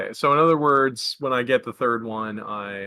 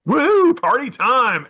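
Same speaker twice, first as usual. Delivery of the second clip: high, loud whoop of joy; excited cheer; 10 semitones higher, 6 dB louder, much slower than usual. Real, not acted. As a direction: cheer it like party time. Player cheers for the party.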